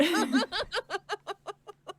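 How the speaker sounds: a burst of high laughter. Real, not acted. Laughing at the cartoon.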